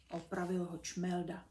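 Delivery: usual speaking voice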